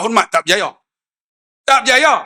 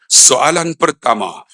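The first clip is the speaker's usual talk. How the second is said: slow, lower contest announcement; Quran contest examiner; 7 semitones lower, slower than usual. Retold or imitated. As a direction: announce it like a quizmaster, drawn out. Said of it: "saya buat macam musabaqah". (gaya juri musabaqah, perlahan)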